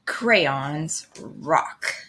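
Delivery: ordinary delivery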